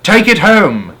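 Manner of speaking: loud, high call; shouting. A narrator's reading. Henry shouting from the balcony to the street. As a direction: keep shouting down to him. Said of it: "Henry shouted".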